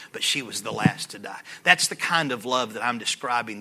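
ordinary talk, nothing marked

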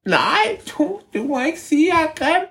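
very high squeaky creature voice; tiny high creature voice; ævle bævle voice. Acted. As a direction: squeak back like a cheeky hidden creature.

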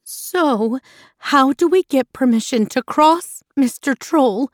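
a touch of storybook bravery; brave asking. A narrator's reading. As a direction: stand up politely to the troll. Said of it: "Mother Gruff asked bravely".

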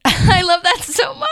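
delighted laughing shout. Real, not acted. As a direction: gush with laughter.

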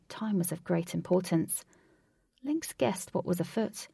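ordinary delivery, nothing marked

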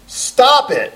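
sudden high, loud shout; yelled command. Acted. Counselor yelling his two-word advice at a client, yelled. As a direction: yell it out at the patient. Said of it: "he yells".

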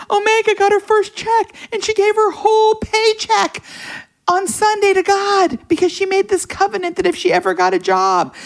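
high-pitched, worked-up protest; retold yelling. Wife yelling to her husband about their daughter's paycheck. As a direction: rant it at the top of your range, as if appalled. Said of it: "what are you yelling about".

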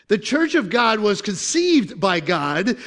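ordinary talk, nothing marked

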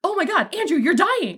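high, swooping cry of dismay; acted alarm. Friend seeing a blood-covered companion, high acted alarm. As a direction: exclaim in high mock horror.

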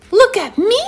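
high-pitched little kitty showing off; proud, high kitten voice; eager kitty. Acted. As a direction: call out for attention in a high voice. Kitty shows off once more.

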